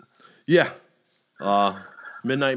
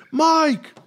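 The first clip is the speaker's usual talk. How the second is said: calling out; 5 dB louder than usual. Retold or imitated. Strangers calling him by mistake.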